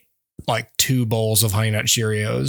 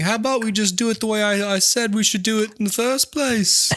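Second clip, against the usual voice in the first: higher, fast, passive-aggressive put-on voice; underhanded Paul; 8 semitones higher than usual. Paul undercutting John, high and passive-aggressive. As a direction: needle him sweetly. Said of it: "Even being underhanded and like".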